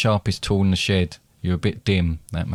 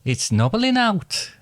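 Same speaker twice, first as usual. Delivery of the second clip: high, slow Welsh accent; 9 semitones higher, slower than usual. Shown as a demonstration. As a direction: say it slowly in a high Welsh lilt. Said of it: "going on to now Welsh".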